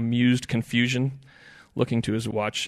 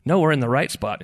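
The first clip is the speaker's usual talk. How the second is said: louder, raised contradiction; insistent; 4 semitones higher, 8 dB louder than usual. A narrator's reading. Ed defends the fishing spot, insistent. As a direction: insist on it firmly. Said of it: "insisted Ed".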